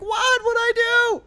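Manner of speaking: very high-pitched squealed protest; hammed-up shrieking child; Christmas Story kid. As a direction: imitate a kid wailing his innocence over the phone. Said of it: "like the kid in, uh, Christmas Story".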